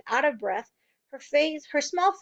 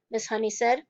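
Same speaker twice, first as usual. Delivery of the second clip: ordinary delivery, nothing marked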